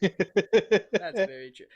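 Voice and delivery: slow, loud laughing reply; laughing agreement. Real, not acted.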